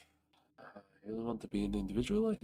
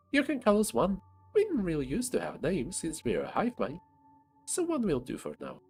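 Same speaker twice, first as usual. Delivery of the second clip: tiny ant voice; 8 semitones higher, 4 dB louder than usual. Acted. Ant character voice.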